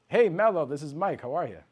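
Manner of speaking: bright lift on the name, settling into friendliness; super welcoming. Shown as a demonstration. Salesman greeting Mello by first name, super welcoming. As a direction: open high and loud, like calling a friend. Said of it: "You see how that's so welcoming?".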